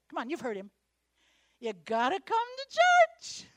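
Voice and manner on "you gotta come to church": slow, loud, high mock preacher call; imitating Bishop. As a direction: ham up the Bishop's plea. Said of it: "What does he say?".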